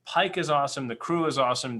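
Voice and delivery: ordinary talk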